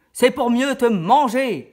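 very loud, slow menacing roar; the wolf lunges and bellows; wolf's roar. Acted. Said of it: "cria le loup". (le loup rugit très fort)

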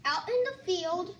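usual speaking voice

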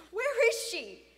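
high, loud urgent question; anxious demand. Acted. Boy asking father about missing friend, anxious. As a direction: press urgently for an answer.

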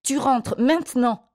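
high-pitched, angry command; scolding, insistent command; stern order. A narrator's reading. Adult orders a child inside.

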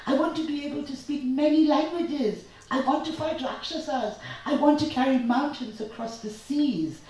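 put-on a boy bouncing on his bed, dying to be a hero; excited child rattling off wishes; eager boy. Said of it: "Raghu said, bouncing up and down on his bed".

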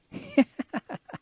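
breathy high giggle of delight; light breathless laugh. Acted. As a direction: laugh with joy at seeing him. Happy laugh on seeing Terry.

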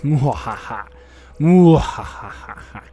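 slow, loud evil villain cackle; hammed-up maniacal mwahaha; maniacal laugh. Gamer trying a villain laugh, loud evil cackle. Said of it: "I can't laugh maniacally right now".

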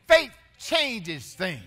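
Faith changes things, slow and loud; slow loud proclamation. Acted. Pastor proclaiming faith slowly and loudly to church. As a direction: lean on every word.

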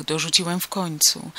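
ordinary delivery, nothing marked

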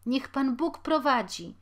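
calling down the stairs after the landlord; woman's farewell. A narrator's reading. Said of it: "odpowiedziała kobieta".

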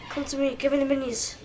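normal talking voice

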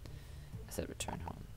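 slow, breathy whisper to yourself. Real, not acted. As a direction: whisper it under your breath, slowly. Mutters game line under breath.

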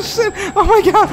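high, shaky panicked laughing; breathless scared giggles; nervous laughter. Real, not acted. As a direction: laugh off the fright. After the jump scare.

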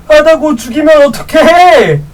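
usual speaking voice

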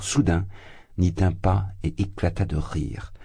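ordinary delivery

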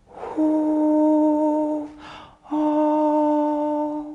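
高而轻的气声，像说悄悄话; 带着叹气感轻轻唱出来; 气声唱法. Shown as a demonstration. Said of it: "需要呢学那个呢说悄悄话的感觉". (像叹气一样的高音气声)

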